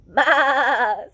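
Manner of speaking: loud laughing baa; sheep baa. Acted. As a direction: bleat it with a giggle. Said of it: "says the sheep".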